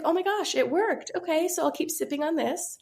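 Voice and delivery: put-on high, delighted tipsy teen voice; excited. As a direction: gush like a tipsy kid. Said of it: "Because I was like".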